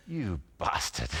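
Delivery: low, slow mock curse; mock reproach. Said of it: "She looks at me and goes".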